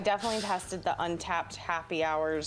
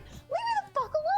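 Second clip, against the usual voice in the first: very high, squeaky retold protest; mock exasperation. Shopper to the stranger who keeps talking, high mock exasperation. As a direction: act out a pent-up plea in high pitch. Her annoyed reaction, acted out.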